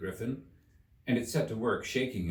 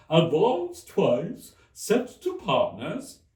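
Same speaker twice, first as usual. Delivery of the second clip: high, loud, mournful Mock Turtle; 7 semitones higher, 6 dB louder, much slower than usual. A narrator's reading. The Mock Turtle quizzes Alice, high and mournful. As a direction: stretch it out like a weepy old turtle.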